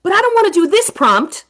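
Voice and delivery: a hint of a surprised student; kids' reaction. Students reacting to the story, lightly voiced. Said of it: "kids will start to see that".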